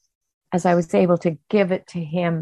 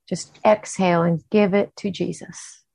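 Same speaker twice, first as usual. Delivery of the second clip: slow guided breathing with long pauses; slow breath prayer. Acted. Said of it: "leading us in kind of that breathing".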